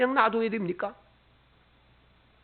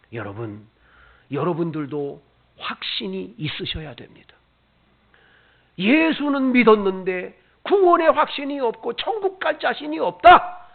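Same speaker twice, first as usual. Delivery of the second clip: ordinary delivery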